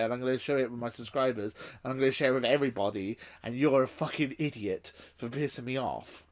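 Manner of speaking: normal talking voice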